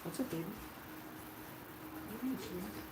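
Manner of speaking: breathy murmur to a puppy. Real, not acted. Cooing to the dog.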